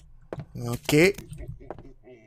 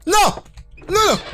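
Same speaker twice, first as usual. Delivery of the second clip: usual speaking voice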